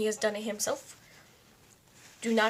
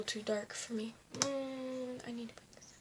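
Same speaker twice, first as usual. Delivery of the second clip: whisper; 9 dB quieter than usual. Real, not acted. Whispered aside.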